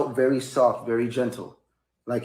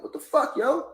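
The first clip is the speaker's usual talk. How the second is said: very high, shocked yelp of protest; acting out being hit out of nowhere; high-voiced disbelief. Retold reaction to being hit again.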